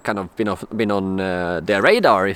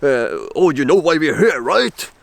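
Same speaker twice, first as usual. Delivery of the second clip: a lightly voiced, higher mock police officer; a hint of a stern cop, higher; 3 semitones higher than usual. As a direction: act out the officer, pitched up a little. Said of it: "they speak".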